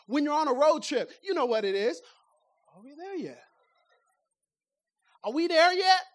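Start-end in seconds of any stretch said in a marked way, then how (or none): 2.7-3.4 soft, whiny question. Shown as a demonstration. Preacher demonstrating the tired passenger's question, much softer. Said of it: "Depending on the inflection of the question". 5.2-6.0 louder, pushier take on it; impatient demand. Shown as a demonstration. Preacher demonstrating the impatient passenger's question, louder. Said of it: "Depending on the inflection of the question".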